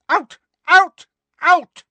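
three loud, piercing high shrieks; screamed. A narrator's reading. Librarian chasing Harry and Ginny out, screaming. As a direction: bark each word as a very high scream. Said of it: "she screamed".

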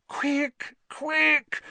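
very high, urgent moan. A narrator's reading. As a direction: plead in a high anxious moan. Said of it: "Hermione moaned".

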